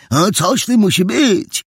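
put-on high, childish echo; squeaky-young, keen repetition; smallest boy detective. A narrator's reading. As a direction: parrot it eagerly in a little boy's high voice. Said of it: "powtórzył najmniejszy z trzech detektywów".

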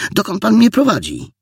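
high-pitched whining protest; tearful cry. A narrator's reading. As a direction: call out plaintively, close to tears. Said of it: "zawołała płaczliwym głosem". (płaczliwy, wysoki głos)

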